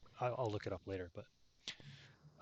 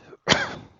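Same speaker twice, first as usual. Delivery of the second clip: loud, real cough; sudden loud coughing burst; hacking cough; 14 dB louder than usual. Real, not acted. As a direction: cough as if losing a lung. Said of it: "I'm just losing a lung".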